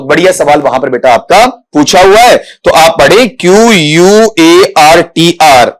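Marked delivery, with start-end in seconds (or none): 3.3-5.8 slow, drawn-out letter-by-letter spelling; teacher slowly reciting each letter. Shown as a demonstration. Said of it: "आप spelling क्यों पढ़ रहे हो".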